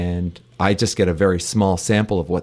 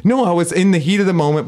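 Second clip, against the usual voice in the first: usual speaking voice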